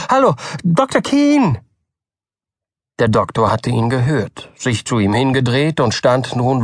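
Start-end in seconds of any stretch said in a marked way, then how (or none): 0.1-1.6 calling out eagerly. A narrator's reading. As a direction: hail the doctor from far off. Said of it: "rief er".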